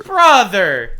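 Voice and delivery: loud, drawn-out brother; exasperated shout. Real, not acted. Streamer groans at the old joke, loud. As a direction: call out in disbelief. Reacting to attack helicopter joke.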